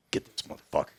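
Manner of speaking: whispered urging. Retold or imitated. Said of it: "and he's like".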